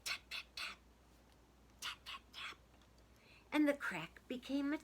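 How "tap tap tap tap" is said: ordinary delivery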